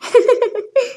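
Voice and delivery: amused laughing. Acted. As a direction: laugh after talking over each other. Both start the same sentence together.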